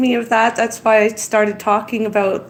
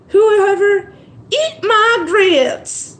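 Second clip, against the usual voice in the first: high, slow drawled catchphrase; sitcom waitress line; 9 semitones higher, much slower than usual. Retold or imitated. Vlogger recalls a sitcom woman's catchphrase, sassy drawl. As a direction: sass it like a diner waitress. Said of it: "the woman would say".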